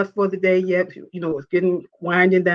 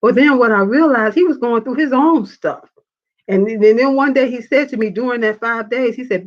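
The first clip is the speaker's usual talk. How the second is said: ordinary delivery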